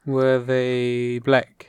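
slow, measured genie question; Akinator. Acted. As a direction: draw out each word of the guess. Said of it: "I'm doing the Akhenaten".

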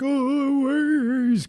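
high, mock-whiny caller voice; hammed-up falsetto. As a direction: whine it up high like a fake-innocent caller. Mocking a suspect's phone call.

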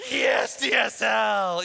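slow, loud, drawn-out cheer; pumped. Retold or imitated. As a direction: celebrate it like a big win. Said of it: "you were, like, pumped to get it".

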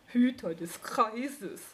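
slow, scornful snort of a repeat; snorting mockery. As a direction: scoff at his grand title. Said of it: "prustete der Igel".

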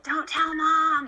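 put-on high, slow sing-out in a kid's role; imagined conspiring kids. Joking as the kids hiding a crash, mock-conspiring. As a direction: stretch it high like scheming children. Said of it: "They're like".

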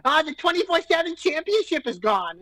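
high, whiny mock of his co-host; mock Jersey boy. Mocking his co-host as a squeaky Jersey boy. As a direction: ham up a squeaky Jersey kid. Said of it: "I don't sound like some, like, prepubescent, like, Jersey boy".

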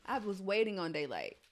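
ordinary delivery

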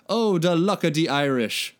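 a hint of an Irish lilt on the luck line; lightly put-on Irish accent, cheerful; read-aloud Irish accent. A narrator's reading. Mike brightly wishing Raph luck in an Irish accent. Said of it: "said happily with a Celtic accent".

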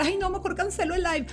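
burla suave de un lamento miedoso, en tono más agudo y más bajo de volumen; quejido imitado. Retold or imitated. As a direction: imita a alguien que se rinde con un "ay" quejumbroso. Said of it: "no te puedes quedar a llorar". (la oradora imita su propio miedo antes del live, con un quejido burlón)

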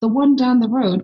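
mock-incredulous repeat of the phrase; retold bafflement. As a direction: act out a taken-aback listener. Baffled echo of the slang.

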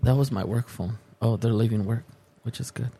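normal talking voice

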